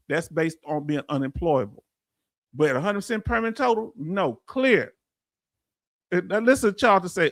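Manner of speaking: normal talking voice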